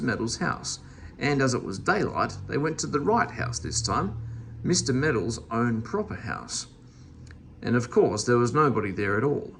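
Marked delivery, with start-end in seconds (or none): none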